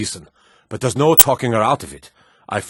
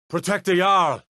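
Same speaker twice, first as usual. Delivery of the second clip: alarmed shout; 8 semitones higher than usual. Acted. Guard raising the alarm to protect the Jarl, shouting. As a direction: yell it out to rally the guards.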